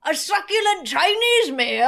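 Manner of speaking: mock Australian quote from a viral clip; Australian accent. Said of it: "he was using this ridiculous accent".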